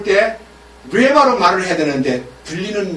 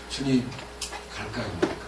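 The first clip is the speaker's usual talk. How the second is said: hushed asking; 8 semitones lower, 11 dB quieter, faster than usual. Acted. Voicing Balaam asking God.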